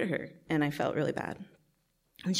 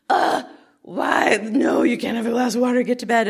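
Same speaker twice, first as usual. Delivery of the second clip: loud, higher angry scolding; losing it; 8 semitones higher, 10 dB louder than usual. Retold or imitated. Big sister yelling at little sister to get to bed, loud angry scold. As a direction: snap at the kid, fed up. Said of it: "I kinda lost it".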